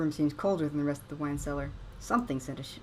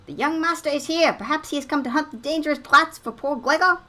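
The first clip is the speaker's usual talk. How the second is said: high, loud servile butler; fawning old servant; 9 semitones higher, 8 dB louder than usual. Acted. Voicing game butler character.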